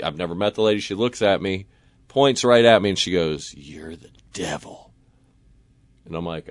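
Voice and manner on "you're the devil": low, hushed, slow accusing voice; ominous accusation. Retold or imitated. Drunk older lady points at a stranger, hushed accusation. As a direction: drop it low and slow, almost under the breath.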